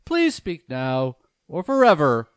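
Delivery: loud, high, ceremonious officiant voice; wedding officiant. Acted. Officiant addressing the wedding guests, high and ceremonious. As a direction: preside over the vows with pomp.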